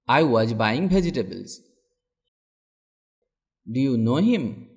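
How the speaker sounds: ordinary delivery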